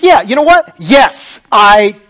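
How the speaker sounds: loud, heated outburst at God; mock furious rant; angry Jonah. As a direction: vent like a fed-up prophet. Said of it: "He's like".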